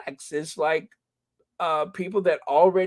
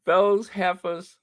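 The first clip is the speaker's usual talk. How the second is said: sassy emphasis; 6 semitones higher, slower than usual. Shown as a demonstration. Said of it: "just lean into it".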